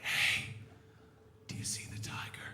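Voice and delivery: hushed unvoiced whisper. Comic to his date, a secret whisper. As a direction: whisper it so the tiger won't hear.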